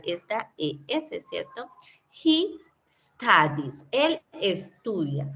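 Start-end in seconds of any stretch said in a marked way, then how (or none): 2.2-5.3 drawn-out, careful He studies; slow model phrase. Shown as a demonstration. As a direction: pronounce He studies slowly for the class. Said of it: "teacher models third person".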